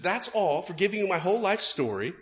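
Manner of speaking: indignant grumble; lightly voiced beggar, offended; ungrateful ex-leper. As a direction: sniff at the small coin. Ex-leper complains about the coin.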